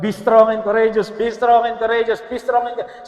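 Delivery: loud, insistent charge; Lord's booming charge to Joshua; God urging Joshua. Retold or imitated. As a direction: repeat it like a firm command, building then easing. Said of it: "kaya nga, pagpasok ng Book of Joshua".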